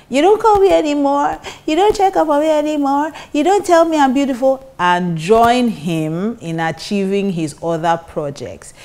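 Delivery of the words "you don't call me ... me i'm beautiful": high, whiny complaining wife; pestering whiny nagging. Retold or imitated. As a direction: pester him in a high whine. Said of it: "you need to quit nagging about".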